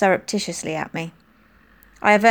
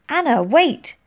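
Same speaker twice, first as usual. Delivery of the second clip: calling out; 7 semitones higher, 3 dB louder, slower than usual. A narrator's reading. Molly calling after Anna.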